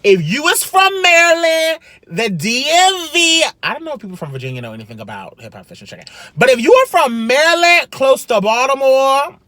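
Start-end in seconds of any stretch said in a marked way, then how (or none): 0.0-3.4 high, drawn-out shout-out to viewers; rally the audience in a high, raised voice; emphatic call-out. Real, not acted. Vlogger appealing to viewers about a chicken spot, emphatic call-out. 6.4-9.4 high, loud shout-out to the locals; emphatic call-out. Real, not acted. Vlogger singling out Maryland viewers, emphatic call-out.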